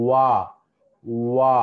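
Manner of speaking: వా with lips fully rounded into a circle; rounded wa demo. Shown as a demonstration. Teacher demonstrating the rounded వా to students, lips fully rounded. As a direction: sound out వా slowly, lips pushed round.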